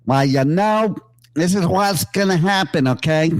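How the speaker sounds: put-on half-hearted New York accent; mock Brooklyn accent. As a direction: lightly play a streetwise Brooklyn guy laying it down. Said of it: "someplace where they're like".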